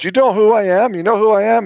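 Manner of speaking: high, loud indignant demand; mock-shouting his authority at the guard. Retelling his friend yelling at the guard, loudly indignant.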